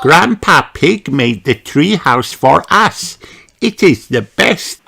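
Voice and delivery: put-on proud, high little-girl voice; Peppa's voice. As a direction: boast about the treehouse like a small girl. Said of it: "said Peppa".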